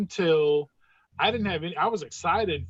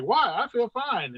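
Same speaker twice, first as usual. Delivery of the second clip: high-pitched puzzled mock innocence; retold breezy protest; 7 semitones higher than usual. Patient brushing off the ER staff's alarm, puzzled and high-pitched. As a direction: act out a baffled, higher-voiced denial.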